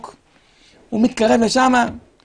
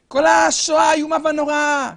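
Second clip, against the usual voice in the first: ordinary talk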